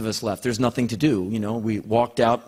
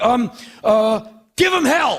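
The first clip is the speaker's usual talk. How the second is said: much higher, louder, fumbling cheer; awkward blurt; 11 semitones higher, 4 dB louder, slower than usual. Retold or imitated. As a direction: blurt it out, grasping for words. Blurting farewell to Paul.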